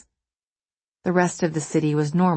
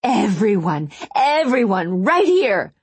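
building from raised to high, loud yell; yelling to a crowd; 5 semitones higher, 5 dB louder, slower than usual. A narrator's reading. Wronged wife summoning passers-by, building high yell. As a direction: call everyone over, climbing higher. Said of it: "she yelled and pointed".

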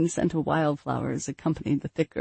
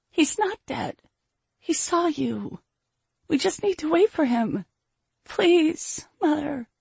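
put-on very high-pitched child begging her mother; tearful pleading daughter; 11 semitones higher, slower than usual. A narrator's reading. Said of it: "and she sobbed".

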